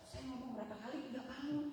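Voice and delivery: normal talking voice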